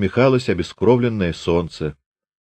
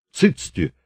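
harsh hushing; 5 semitones higher than usual. A narrator's reading. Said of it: "зыкнул Пантелей Прокофьевич".

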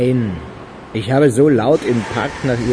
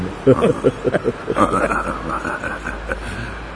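amused chuckle at the joke; mocking laugh; 3 semitones lower than usual. Accomplice laughs at the early-release joke, amused. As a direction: laugh at the jab.

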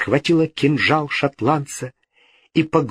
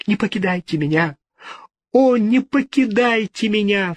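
high, fast desperate plea; отчаянный крик; 10 semitones higher than usual. A narrator's reading. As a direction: beg him not to leave, voice rising. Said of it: "вскричала в отчаянии". (в отчаянии, высоко)

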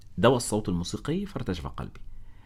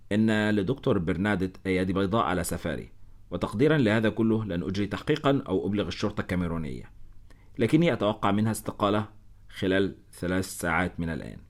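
ordinary delivery, nothing marked

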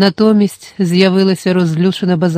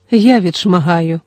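usual speaking voice

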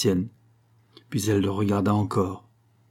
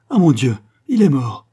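much louder, higher cry of dread; anguished cry; 4 semitones higher, 8 dB louder than usual. Acted. Concepción cries out fearing her fiancé dead. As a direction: cry out in fear for him. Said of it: "Concepción jeta un cri".

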